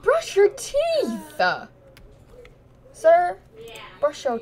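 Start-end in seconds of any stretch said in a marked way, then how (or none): 0.0-1.4 loud, very high, drawn-out command; shouted order. Pretend. Telling someone with disgusting teeth to brush, shouted order. 2.9-3.4 loud, high address; loud call-out. Pretend. Addressing someone with disgusting teeth, loud call-out.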